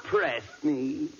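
hammed-up lovesick pleading; drawn-out, put-on female swooning; playing the woman. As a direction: plead like a mock sweetheart. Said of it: "I'll be the woman".

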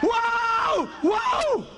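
very high drawn-out wail; acted alarm cry. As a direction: cry out as the plane drops. Acted cry as plane falls.

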